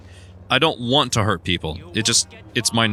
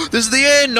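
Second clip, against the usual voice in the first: excited; 6 dB louder than usual. Real, not acted. Excited reaching the game's end.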